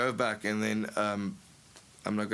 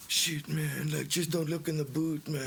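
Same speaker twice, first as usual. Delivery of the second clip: nervous guilty plea, slightly higher; mock panic; 3 semitones higher than usual. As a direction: plead like you've got something to hide. Pretend panicked guilty driver.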